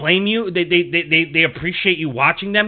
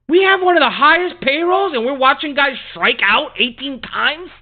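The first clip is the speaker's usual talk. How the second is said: incredulous shouting, pitched way up; exasperated rant; 7 semitones higher than usual. Real, not acted. Fan venting at the Yankees' strikeouts, furious disbelief. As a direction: blow up at the team's failure.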